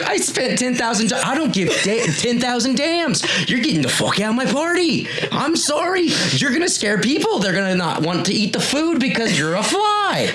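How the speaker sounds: high, worked-up Cockney tirade; upset party host. Acted. Host telling a fly to leave his party, upset. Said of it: "that's [name] getting upset as the host".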